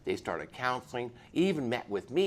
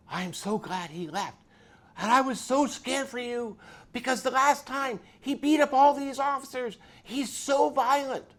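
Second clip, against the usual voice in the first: worried wife; 11 semitones higher, 7 dB louder than usual. Retold or imitated. Frightened wife recounts the danger.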